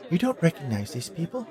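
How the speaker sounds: high female character voice; Bee Girl. A narrator's reading. Bee Girl questions Harrison.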